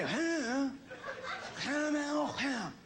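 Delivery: mock garbled old-man noises; unintelligible grandpa. Grandson mimicking grandpa's language from hell, garbled mock mumbling. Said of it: "he talked in a language from hell".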